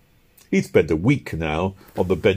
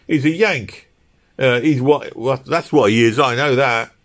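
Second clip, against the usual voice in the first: louder, higher working man arguing; heated; 5 semitones higher, 5 dB louder than usual. A narrator's reading. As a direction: insist heatedly in a labourer's voice. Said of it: "the man cried heatedly".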